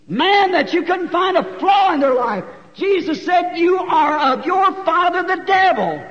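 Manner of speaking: loud, high, shouted sermon peak; Jesus rebuking the priests, shouted high and loud; preaching at full force. Real, not acted. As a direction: thunder the rebuke at the crowd.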